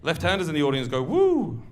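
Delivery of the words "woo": high-pitched "woo" cheer; high crowd-prompting whoop. Shown as a demonstration. Cueing the audience to cheer.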